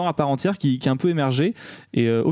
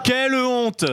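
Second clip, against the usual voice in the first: indignation moquée; 11 semitones higher, much slower than usual. Pretend. Mock outrage repeated.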